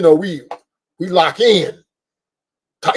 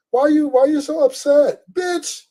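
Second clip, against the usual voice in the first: pitched way up, mocking a woman's whine; mock nagging woman; 9 semitones higher, slower than usual. Imitating his woman at the game, high mocking whine.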